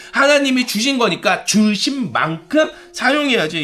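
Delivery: high, impassioned sermon delivery; forceful preaching. Real, not acted. Preacher correcting the congregation on the talents parable, impassioned high preaching.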